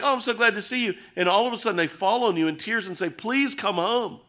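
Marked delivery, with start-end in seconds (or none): 0.0-0.9 bright, higher happy greeting to family; glad greeting. Pretend. Worker greeting his arriving wife and toddlers, excited. 3.1-4.2 slow, higher pleading plea; tearful plea. Pretend. Family in tears begging the worker to return, pleading.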